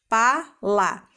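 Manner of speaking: "Pala" in two separate beats; syllable by syllable. Shown as a demonstration. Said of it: "Duas sílabas".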